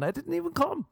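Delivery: sudden very high squeal; mock scream. Locked up and still screaming, very high mock scream. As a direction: jump up into a hammed-up screamed protest. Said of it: "I just kept screaming".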